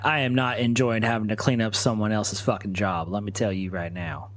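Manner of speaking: usual speaking voice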